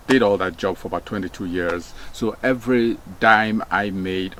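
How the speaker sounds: normal talking voice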